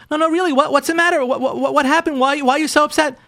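high, stammering retold husband; imitated anxious questioning; flustered concern. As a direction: act out a baffled husband pleading to understand. Husband anxiously questions crying wife.

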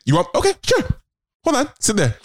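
high, mocking dismissal; condescending. Border officer dismissing the traveler, condescending. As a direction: brush him off like trash. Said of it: "talking to me like I'm fucking trash".

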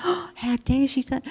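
gasp, then high scandalised whisper-free exclamation; mock indignant shock. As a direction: imitate scandalised onlookers. Imitating shocked bystanders after the outburst.

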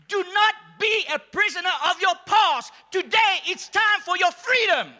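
preaching shout. Acted. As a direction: proclaim freedom at full force. Preaching climax to congregation.

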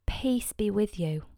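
soft whisper, slow; whispered greeting. Acted. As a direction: breathe the words gently. Risen Jesus greets the disciples.

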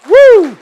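high, loud whoop; preacher's whoop. Real, not acted. Preacher hyping the congregation, whooping. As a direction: let out a joyful whoop.

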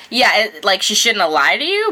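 ordinary talk, nothing marked

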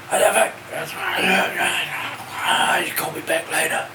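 croaky, half-whispered rasp. Retold or imitated. Musician dismissing the early caller, imitated rasp. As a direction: imitate an irritated hoarse mumble. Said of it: "I hear this voice going".

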